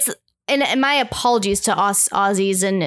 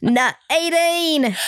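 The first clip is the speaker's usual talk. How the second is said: Aussie accent; 6 semitones higher, much slower than usual. Shown as a demonstration. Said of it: "can you do it in both?".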